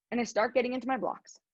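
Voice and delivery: ordinary talk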